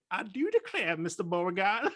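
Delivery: Southern accent done badly on request. Shown as a demonstration. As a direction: ham up a Southern belle line. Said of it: "That was terrible".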